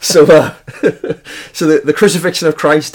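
speaking through leftover laughter; stifled giggles. Real, not acted. Recovering from a giggling fit.

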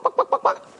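very high squawking buck-buck; chicken clucking. Retold or imitated. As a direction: cluck like a flock of frantic chickens. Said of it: "they're making such a ruckus".

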